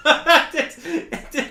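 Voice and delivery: high, loud burst of laughter; real laughter. Real, not acted. Laughing at the mention of Jerry, real laughter. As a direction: crack up and talk through it.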